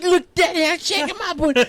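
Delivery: high, excited child imitation; gleeful little daughter. Daughter showing off to dad, excited child voice. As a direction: squeal it proudly like a small kid.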